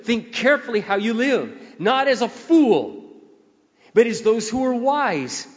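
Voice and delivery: slow, high, ringing scripture proclaimed to the church; preaching at full force. Acted. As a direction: lift every word up to the congregation.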